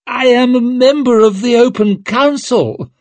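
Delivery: high, loud outraged protest; indignant protest. A narrator's reading. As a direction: cry out against the verdict. Condemned lord protests his verdict.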